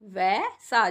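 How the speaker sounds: वैसा with the ऐ stretched long and loud; drawn-out vaisa. Shown as a demonstration. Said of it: "जब हम किसी चीज़ को ज़्यादा खींच के बोलते हैं". (वैसा को खींचकर बोलना)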